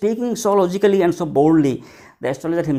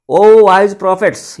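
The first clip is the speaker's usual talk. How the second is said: loud, slow call to an assembly; a big, ringing address to the wise men; proclaiming; 4 semitones higher, 10 dB louder, slower than usual. Acted. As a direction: proclaim it grandly, higher and drawn out. Boy addresses the astrologers.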